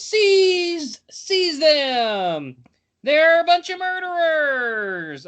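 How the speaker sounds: high, drawn-out accusing cry; theatrical high-pitched yell; yelling guard. A narrator's reading. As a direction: cry out like a guard raising the alarm.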